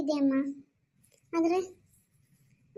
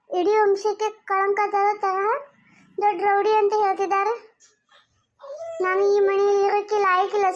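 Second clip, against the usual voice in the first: anguished outburst; 3 semitones higher, 5 dB louder than usual. Acted. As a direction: pour out the hurt loudly. Daughter's anguished outburst about father.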